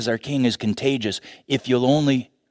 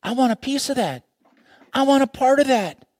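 eager onlookers; 12 semitones higher than usual. Acted. Others wanting in.

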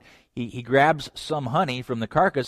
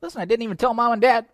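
exasperated husband; 9 semitones higher, much faster than usual. Retold or imitated. Samson answers the nagging wife.

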